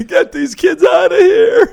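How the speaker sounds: very high, loud laughing cry; laughing shout. Pretend. Laughing joke about grandkids chaos.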